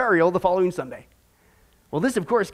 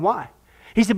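ordinary delivery

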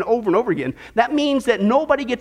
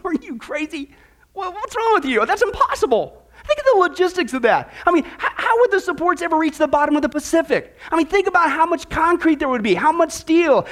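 high, laughing incredulous genie; 6 semitones higher than usual. Retold or imitated. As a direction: scoff at the wish, laughing. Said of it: "the genie, he laughs at Al, and he goes".

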